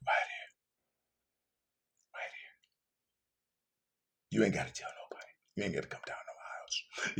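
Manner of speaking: breathy hushed whisper, coaxing; whispered invitation. Real, not acted. Preacher whispering an invitation to the hurting listener. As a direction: whisper it gently like a secret.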